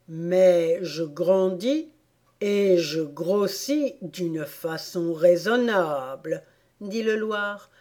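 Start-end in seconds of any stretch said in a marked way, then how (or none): 0.1-6.4 very slow Dormouse reply; self-righteous Dormouse. Acted. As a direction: MAIS JE GRANDIS drawn out slowly. Dormouse replies to Alice.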